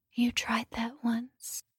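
slow cold whisper. Acted. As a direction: whisper it menacingly into the mic. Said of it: "I whisper into the microphone".

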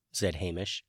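ordinary delivery

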